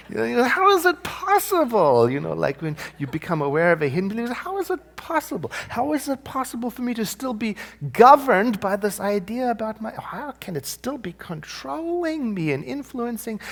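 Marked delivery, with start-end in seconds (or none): 0.1-2.1 high, loud burst of disbelief; incredulous. Acted. Someone finding their own hidden belief, incredulous. 4.3-13.4 high-pitched, exasperated disbelief; incredulous. Acted. Someone aware they are still governed by an idea, incredulous. Voicing disbelief at hidden belief.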